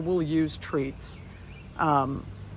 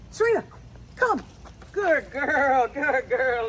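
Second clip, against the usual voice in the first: high happy call then praise; high cheerful praise for the dog; 7 semitones higher than usual. Real, not acted.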